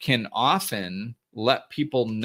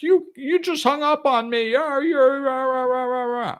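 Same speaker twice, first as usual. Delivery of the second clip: much higher, louder ranting gibberish; mock yelling caller; 4 dB louder than usual. The yelling caller ringing back after the hang-up, in a hammed-up high rant. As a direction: ham up an angry caller's rant.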